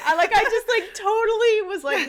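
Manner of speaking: laughing loudly while telling it. Real, not acted. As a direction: laugh through the words. Laughing while retelling embarrassment.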